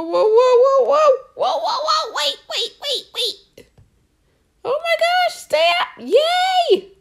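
high, loud panicked yelling; excited squeals. Real, not acted. Gamer squealing at her tumbling box, high and loud. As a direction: shriek it out as the box slides. Box sliding during game.

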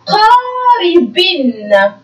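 قاربن read very slowly, the final نّ of قاربن drawn out. Shown as a demonstration. Teacher to pupils, slowly sounding out قاربن with a long final nun. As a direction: stretch قاربن syllable by syllable, holding the nun sound at the end. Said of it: "إذن نعيد القراءة".

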